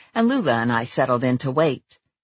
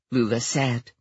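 ordinary talk

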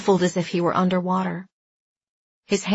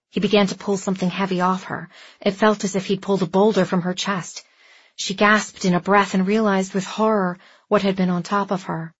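usual speaking voice

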